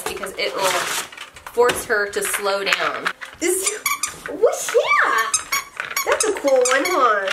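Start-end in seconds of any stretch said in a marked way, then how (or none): 3.4-7.3 high squeaky coo. Real, not acted. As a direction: ask the puppy sweetly. Baby talk to dog.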